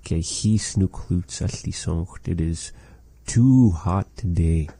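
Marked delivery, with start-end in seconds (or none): none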